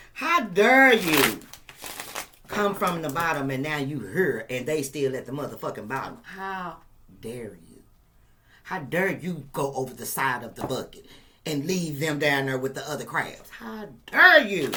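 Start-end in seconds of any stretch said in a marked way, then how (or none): 0.1-1.4 mock outrage. 13.5-14.7 mock outrage.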